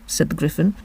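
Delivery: usual speaking voice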